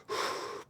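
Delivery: soft, controlled breath out before taking aim; steadying exhale. Pretend. Shooter settling to take aim, steadying exhale. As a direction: let out a slow, focusing breath. Acts out the moment before aiming.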